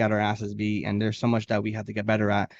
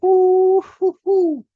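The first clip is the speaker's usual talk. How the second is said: high falsetto hoots, loud; excited hooting; 10 dB louder, much slower than usual. Pretend. Hyped anime fan reacting to Asta's vow, loud falsetto hoots. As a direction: whoop like a hyped fan at the twist. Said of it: "I'm like".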